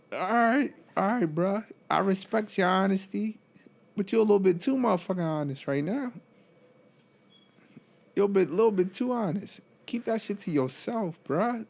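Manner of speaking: half-laughing, telling him off; exasperated scolding. Real, not acted. Mock advice to patient.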